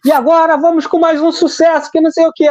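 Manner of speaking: high-pitched mock TV host hype; imitating Gugu. Host milking the audience, announcing another hit, high mock hype. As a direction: announce the next hit like a pushy Sunday show host. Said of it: "o Gugu falava assim".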